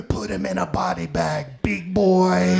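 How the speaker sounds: imitated Australian voice in his head; Australian accent. Australian voice in his mind urges him to fight, mock Australian accent. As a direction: put on an Aussie accent, egging him on. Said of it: "I heard this Australian voice being like".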